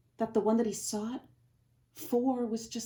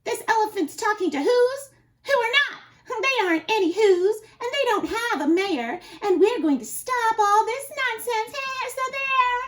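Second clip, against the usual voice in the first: loud, high mocking jeers; shouting; 13 semitones higher, 8 dB louder than usual. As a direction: monkeys jeering in a rowdy shout. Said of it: "came shouting".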